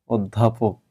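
অধ্যাপক with open অ, low and soft; wrong pronunciation. Retold or imitated. Teacher echoing student's error, অধ্যাপক with an open first vowel. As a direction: softly repeat the mistaken open-vowel অধ্যাপক. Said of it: "এটা কিন্তু তুমি ভুল উচ্চারণ করেছ".

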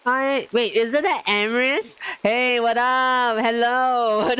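hyped streamer welcome; excited raid greeting. Real, not acted. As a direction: greet the crowd pouring in, thrilled. Greeting incoming raid viewers.

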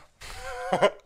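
very high burst of laughter; squeaky high laugh. Real, not acted. Laughing at own joke.

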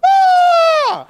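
very loud, high, sustained wail; long comic wail at full volume; mock wail. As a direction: let out a huge hammed-up bawling cry. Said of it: "I wailed. I went".

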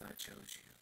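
breathy whisper, falling away; hushed whisper. Acted. Preacher voicing God's hushed words on the doorpost. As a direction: breathe it like a secret.

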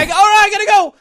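very high, loud forced cheer; awkward hasty goodbye. Retold or imitated. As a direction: squeak it out and bolt.

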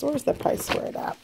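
hushed whisper while searching for the tag; whispered to herself. Real, not acted. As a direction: breathe the words under her breath as she hunts. Searching the bag for the price tag.

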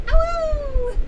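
high loud yelp; jackal howl. Acted. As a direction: yelp like a small dog. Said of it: "Doggo says".

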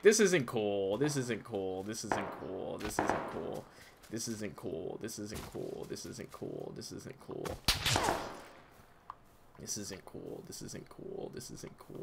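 repeated, dying down to whisper; fading to whisper. Real, not acted. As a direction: let it fade to nothing.

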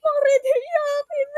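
high, slow laughing taunt; laughing tease. Retold or imitated. Teasing crying friends on stage, high laughing taunt. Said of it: "tumatawa kami".

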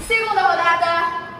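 drawn-out game show call; announcer voice. Acted. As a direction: announce the round slowly and loud. Announcing next round.

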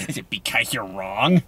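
a mock nerd taunt. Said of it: "stereotypical, like, nerd voices".

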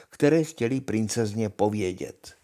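usual speaking voice

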